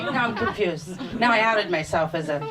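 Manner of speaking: normal talking voice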